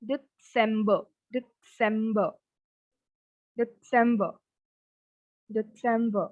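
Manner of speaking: December split as 'Det-sembuh', R dropped; German December, slight T. Shown as a demonstration. Teacher drills German December, a slight T before sem. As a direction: put a small T inside each December. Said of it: "We have a slight T here".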